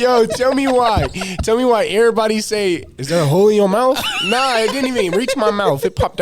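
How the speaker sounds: half-sing it in loud disbelief; excited. Real, not acted. Mock-complains about the jaw joke.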